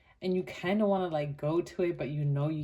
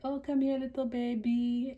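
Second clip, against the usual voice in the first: put-on cooing at a baby; 8 semitones higher than usual.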